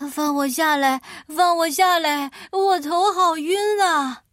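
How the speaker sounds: screaming. Acted. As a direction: scream to be let down, dizzy. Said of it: "铁皮人尖叫着".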